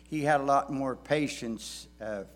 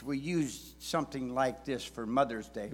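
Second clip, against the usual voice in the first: usual speaking voice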